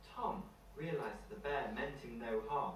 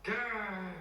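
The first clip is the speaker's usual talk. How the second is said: a playful storybook growl, higher and a bit louder; bear growl; 7 semitones higher, 4 dB louder than usual. Acted. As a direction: growl like a friendly bear for kids.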